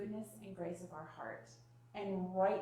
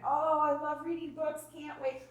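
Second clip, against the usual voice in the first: upbeat cheer; 6 semitones higher, 7 dB louder than usual. Shown as a demonstration. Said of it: "that's your enforceable statement".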